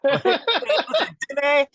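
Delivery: punning on a friend's name, high and giggly; teasing pun. Retold or imitated. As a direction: squeal the pun through a laugh. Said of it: "I kept teasing my buddy".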